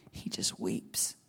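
slow, soft whisper; hushed whisper. Real, not acted. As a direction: let it drop to a breath. Describing Jesus weeping, hushed.

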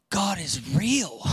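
slow, breathless, gasping in real awe. Real, not acted. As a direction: let the truth hit you and gasp it out. Breathless awe at answered prayer.

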